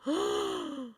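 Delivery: sharp high gasp of shock. Retold or imitated. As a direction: gasp at what you just did. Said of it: "And I was like".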